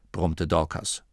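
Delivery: plain reading voice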